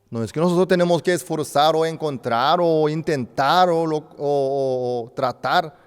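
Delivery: loud, high fervent preaching; loud, emphatic sermon climax; full-force preaching. Acted. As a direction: drive the point home to the flock.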